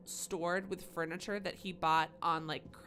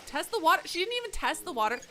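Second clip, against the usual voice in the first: much higher and louder, scolding the character; exasperated scolding; 9 semitones higher, 5 dB louder than usual. Real, not acted. Gamer scolding her character for jumping in unchecked. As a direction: yell at her like a reckless friend.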